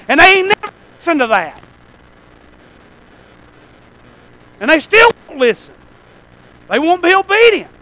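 loud, high, impassioned pulpit shout; full-force preaching. Real, not acted. Preacher urging young people to obey God, shouting with conviction.

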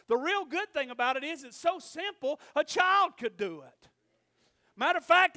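full-force Southern revival preaching. Real, not acted. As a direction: drive it out to the congregation, loud on key words.

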